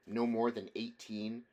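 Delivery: ordinary delivery, nothing marked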